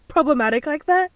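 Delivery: high, loud, breaking into laughter; helpless high giggly delivery; laughing. Real, not acted. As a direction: crack up while talking. Cracking up at own joke.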